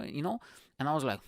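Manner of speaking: usual speaking voice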